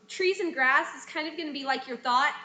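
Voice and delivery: normal talking voice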